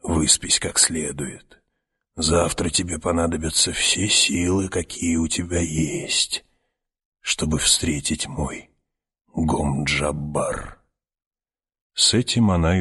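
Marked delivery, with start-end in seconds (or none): 0.0-10.8 put-on a quiet threat from an old witch; old woman voice. Старуха угрожает мальчику. (тихая угроза старухи)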